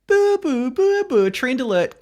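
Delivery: high-pitched mock siren announcement; trend alert jingle. Podcaster announcing their own segment, mock alert jingle. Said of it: "I'm gonna do my trend alert".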